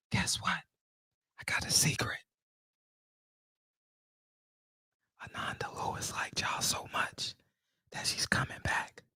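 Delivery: hushed, playful whisper; mock secret whisper. Host letting viewers in on a secret, whispering. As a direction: whisper it like confidential gossip.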